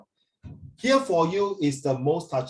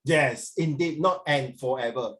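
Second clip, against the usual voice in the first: ordinary talk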